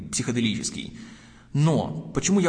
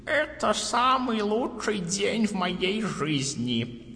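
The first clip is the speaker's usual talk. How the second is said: put-on old man voice; 6 semitones higher, much slower than usual. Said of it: "И он говорит".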